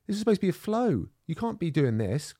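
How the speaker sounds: high, indignant, slowing at the end; mock protest. Protesting to a sparring partner who started strangling him, high and mock-indignant. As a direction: sound scandalised that the flow became a fight. Retells protesting mid roll.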